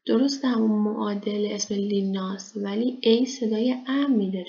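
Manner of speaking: normal talking voice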